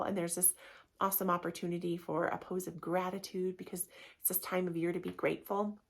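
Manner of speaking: normal talking voice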